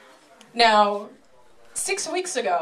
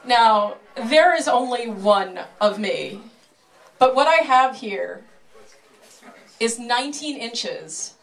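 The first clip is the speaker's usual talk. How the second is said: showman patter. Acted. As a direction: sell it like a sideshow barker. Showman presents the sword.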